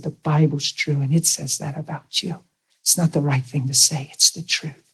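tender reassurance. Retold or imitated. Husband quietly reassuring his hurting wife, soft and tender. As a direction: say it softly, close to her.

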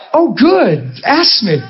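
delighted to be asked, playfully overdone; eager delight. Retold or imitated. Preacher retelling his reply to a child with an accent, eager.

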